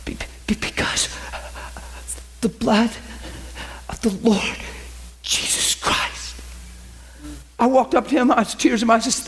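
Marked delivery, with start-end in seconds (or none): 0.1-6.3 weak stutter with long gaps, fading into breathy whisper; stuttering dying man. Retold or imitated. Dying man answering the preacher, halting stutter. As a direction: struggle to get each word out. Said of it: "stopped stuttering".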